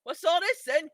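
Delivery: loud mock British bobby; British accent, loud and hammy. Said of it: "I'm gonna start talking in a British accent".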